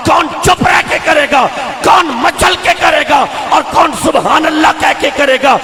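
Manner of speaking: shouted, high-pitched sermon oratory; fiery preacher at full voice; full-force preaching. Real, not acted. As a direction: build to a ringing, crowd-rousing shout.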